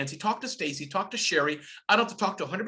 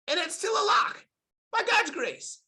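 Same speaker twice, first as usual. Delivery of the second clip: emphatic shout; 13 semitones higher than usual. Real, not acted. To the audience about marriage, an emphatic joyful shout. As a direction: call it out proudly, hitting lock.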